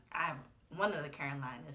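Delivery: ordinary delivery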